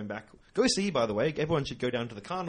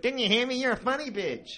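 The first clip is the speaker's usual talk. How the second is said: put-on much higher, louder drunken heckle; drunk bogan voice; 13 semitones higher, 5 dB louder than usual. Drunk woman yelling after the comedians, high and loud. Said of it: "And she went".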